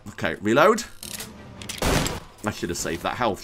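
ordinary delivery

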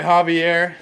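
normal talking voice

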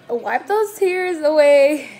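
loud, drawn-out mock order to yourself; playful self-scolding. Emotional vlogger telling herself to stop crying, playful self-scolding. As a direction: stretch it out high and loud, teasingly.

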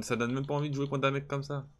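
usual speaking voice

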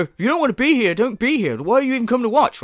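mock an indignant streamer; mocking impression. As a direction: imitate him scolding his viewers. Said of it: "he'll say that, right, and go".

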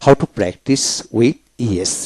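very slow, each word spelled out; teacher reading the lesson title slowly to students, slow and clear; slow teaching read. Shown as a demonstration. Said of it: "তাই আসুন আজকে আমরা শিখব".

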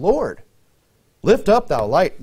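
pleading call, mildly acted. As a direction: call upward to be heard. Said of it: "they're calling him by his name".

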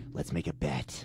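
low, put-on caricature of a girlfriend; girlfriend impression. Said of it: "She doesn't sound like that".

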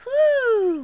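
high, loud whoo of relief; relieved whoo. Pretend. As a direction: cheer at reaching the top. Relief after climb.

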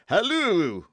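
loud, high hailing call. A narrator's reading. Jussac hails the duelling musketeers loudly. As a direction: call out to stop them. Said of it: "cried Jussac".

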